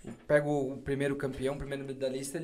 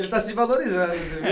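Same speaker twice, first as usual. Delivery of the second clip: laughing; 6 semitones higher, 7 dB louder than usual. Real, not acted. Teasing friend while laughing.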